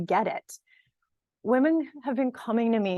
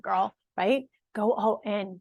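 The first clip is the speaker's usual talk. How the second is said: ordinary talk, nothing marked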